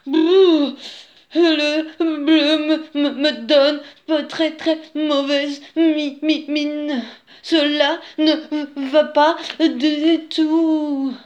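high, loud, slow shivering stutter; teeth chattering. A narrator's reading. Wolf out of an icy bath, stuttering with cold. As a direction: stammer it out, frozen through. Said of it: "En claquant des dents".

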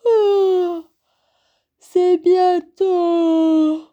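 very slow, high yawned words; yawning. A narrator's reading. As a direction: stretch the words through a big yawn. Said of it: "Petit Ours bâilla". (en bâillant, voix ensommeillée)

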